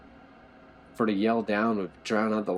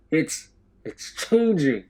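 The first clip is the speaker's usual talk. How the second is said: slow, stammering frightened call, higher; scared call; 8 semitones higher, 6 dB louder, slower than usual. A narrator's reading. Prisoner calling up to the cells, frightened. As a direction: call back up in fear. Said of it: "The man who is not sobbing called back".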